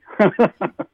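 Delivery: short, high, real laugh; amused laugh. Real, not acted. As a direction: burst out laughing in disbelief. Laughs at his unbelievable lottery win.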